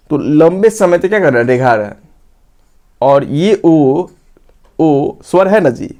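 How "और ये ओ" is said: very slow ओ stretched long; drawn-out ओ. Shown as a demonstration. Teacher stretching ओ to show the triple-length vowel. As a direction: draw the ओ out slowly. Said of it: "ये लंबे समय तक हम रेखा रहे हैं".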